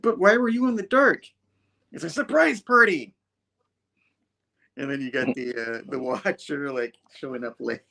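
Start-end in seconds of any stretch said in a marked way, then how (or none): 0.0-3.1 mock comic voices. Comic characters voiced in retelling.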